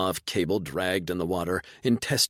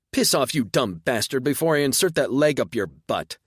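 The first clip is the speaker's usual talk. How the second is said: loud snarling threat, higher; angry snap; 6 semitones higher, 4 dB louder than usual. A narrator's reading. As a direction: snap it at him with a sneer. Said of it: "Yorka snapped".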